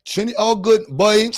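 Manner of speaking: put-on quick try at a Trinidadian accent; Trini accent. Streamer doing Trini on request from chat, Trinidadian accent. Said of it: "Do the Trini accent".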